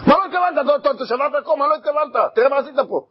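high, shocked shouting at the intruder; outraged homeowner. Acted. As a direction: yell at the man wrecking your walls. (בעל בית צועק בזעם על ההורס)

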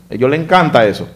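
normal talking voice

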